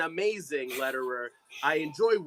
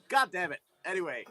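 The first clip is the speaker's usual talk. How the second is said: usual speaking voice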